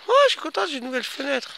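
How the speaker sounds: high squeal of joy; delighted. Real, not acted. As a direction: squeal it out happily. Delighted at a new window.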